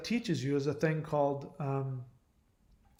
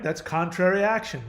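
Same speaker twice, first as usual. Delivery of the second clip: normal talking voice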